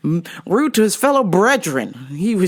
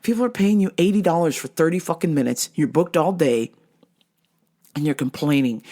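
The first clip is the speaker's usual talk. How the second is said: ordinary talk, nothing marked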